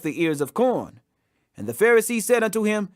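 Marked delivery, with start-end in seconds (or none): none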